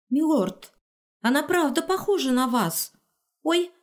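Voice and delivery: put-on excited young dryad. Dryad voiced high.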